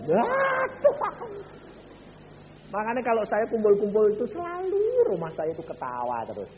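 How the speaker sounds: normal talking voice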